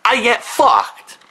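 real exasperated shout; angry outburst. Real, not acted. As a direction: yell your frustration at Sony. Ranting at Sony.

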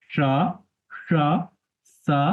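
"সা" said three ways, as palatal sha, retroflex sha, dental sa; three sibilants distinguished. Shown as a demonstration. Teacher replacing letter names, "সা" as sha, retroflex sha, then sa. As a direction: say "সা, সা, সা" as two hushed sh sounds, then a sharp s. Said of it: "এভাবে পড়বো না".

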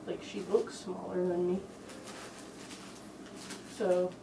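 speaking through full head mask; muffled inside costume head. Real, not acted. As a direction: voice dampened by mascot head. Seen 0: Speaker wears full fursuit head over face throughout labelled words.

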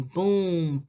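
usual speaking voice

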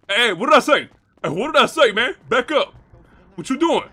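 high, loud threatening yell; loud enraged warning; angry shout. Acted. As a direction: bark him off the block. Threatening intruder on block.

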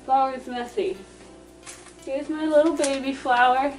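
ordinary delivery

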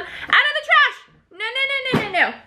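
sharp high calls of no; scolding. Real, not acted. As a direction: stop someone at the trash. Suddenly scolding mid-cleanup.

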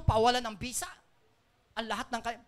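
ordinary talk

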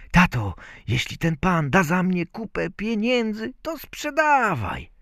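put-on high-pitched little-boy voice; Bździelik. A narrator's reading. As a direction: advise father in a tiny high voice. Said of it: "Bździelik tymczasem powiada ojcu". (wysoki głosik malca)

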